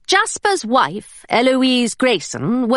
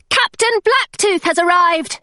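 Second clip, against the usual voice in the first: calling out; 7 semitones higher than usual. Acted. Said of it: "she called".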